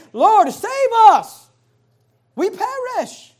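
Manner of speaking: loud, high, slow cry for rescue; desperate plea. Acted. Disciples crying to Jesus in the storm, loud desperate plea. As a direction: beg like men drowning in a storm, high and loud.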